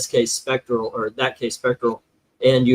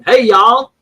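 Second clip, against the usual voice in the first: higher, louder Southern "y'all" greeting; [name]'s put-on accent; 8 semitones higher, 6 dB louder than usual. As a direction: call it out in a mock Southern accent. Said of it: "I'm gonna give him that accent".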